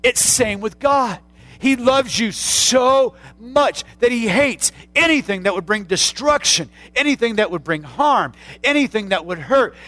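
loud, slow building sermon voice; preacher pressing the point on sin; full-force preaching. Acted. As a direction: drive it home with rising force.

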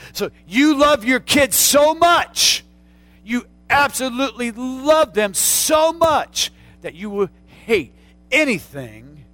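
loud, slow pulpit shout; preacher rising to a shout; full-force preaching. Acted. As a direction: hammer it home to the congregation.

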